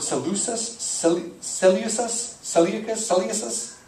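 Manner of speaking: sounding out "Seleucus", rising as if asking; unsure pronunciation. Real, not acted. Preacher stuck on a hard name, tentative and questioning. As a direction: try out "Seleucus" several ways, questioning. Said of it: "don't really know how you say that word".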